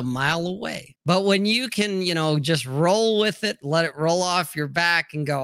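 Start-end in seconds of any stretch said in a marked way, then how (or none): none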